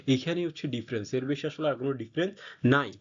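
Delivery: ordinary delivery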